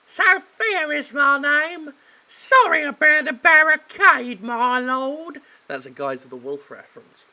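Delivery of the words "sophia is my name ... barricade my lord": high, loud falsetto maid. Acted. Maid calming the butler, falsetto. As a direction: chirp it out sweetly. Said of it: "I will for her".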